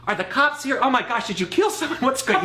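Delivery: high, loud imitation of alarmed dad; mock panic. Son acts out dad woken at night, mock panic. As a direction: play the startled father, half laughing.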